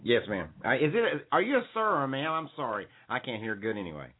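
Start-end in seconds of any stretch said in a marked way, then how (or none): none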